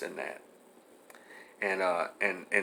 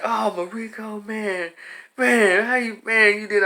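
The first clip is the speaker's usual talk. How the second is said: teammates disappointed; 8 semitones higher than usual. Retold or imitated. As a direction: sigh it at the kid who lost the game. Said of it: "They're like".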